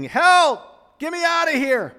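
hammed-up terrified shout; panicked screaming. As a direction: scream like a man trapped in a lion cage. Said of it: "he starts screaming".

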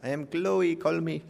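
mock Jamaican psychic voice, quiet; imitating a fake Jamaican accent. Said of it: "that Chloe with the fake Jamaican accent".

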